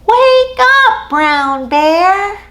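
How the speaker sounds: high loud wake-up call. Shown as a demonstration. Leading kids calling Brown Bear awake, loud and high. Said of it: "we all call out".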